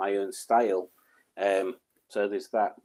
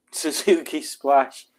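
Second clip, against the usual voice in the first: usual speaking voice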